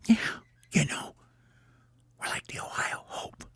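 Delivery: hushed huddle suggestion; soft, secretive whisper. Acted. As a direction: whisper it to the team. Team huddle choosing a name.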